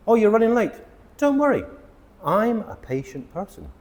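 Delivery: high, bright reassuring example voice; cheery patient friend. Retold or imitated. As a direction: play a relaxed friend who doesn't mind waiting. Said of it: "means the person who is able to wait".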